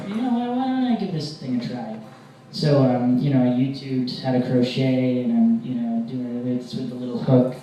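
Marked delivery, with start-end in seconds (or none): 0.1-2.0 high-pitched lift of a fresh decision; sudden bright idea. Acted. Comic deciding to try crochet before Christmas, high eager lift.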